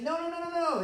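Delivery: preacher imitating his nephew, high child voice; little six-year-old voice. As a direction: squeak it out like a small kid holding back. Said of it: "in his little six-year-old voice".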